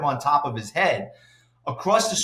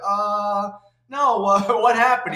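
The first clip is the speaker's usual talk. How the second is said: imitating someone feigning ignorance, higher pitched; playing dumb; 6 semitones higher, slower than usual. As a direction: play it off with a hesitant, raised voice. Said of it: "trying to play it off like he doesn't know".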